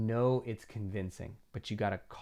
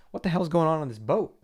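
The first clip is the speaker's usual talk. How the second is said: higher and louder, exasperated; captain's shout; 6 semitones higher, 7 dB louder than usual. Pretend. Captain yelling at his crew about the boat, loud and exasperated. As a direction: bark it like a fed-up skipper. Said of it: "The captain also says".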